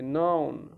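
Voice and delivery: नाउन with 'ow', not 'o'; teacher's model नाउन, falling at the end; correct pronunciation. Shown as a demonstration. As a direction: open the vowel of नाउन to 'ah-oo'. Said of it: "ओ नहीं, ए की आवाज़ निकलती है".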